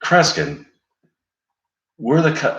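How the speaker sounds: incredulous outburst. Retold or imitated. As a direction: blurt his name in disbelief. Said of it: "it's like".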